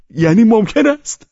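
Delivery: very high, loud elated shout; overjoyed yell at full pitch. A narrator's reading. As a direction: shout it with open delight, rising at the end. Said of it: "شادیش را مخفی نکرد و فریاد کشید".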